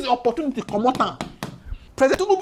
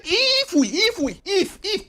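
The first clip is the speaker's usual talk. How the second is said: if jumps much higher each time; stress on if; 9 semitones higher, slower than usual. Shown as a demonstration. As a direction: hammer the word if again and again to mock the condition. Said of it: "conditional statement".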